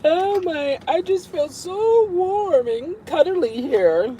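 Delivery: high, slow, cooing pet voice; voicing the pet. Owner speaks for her chubby dog in a high, slow cuddly voice. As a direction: speak for the dog, snug and content.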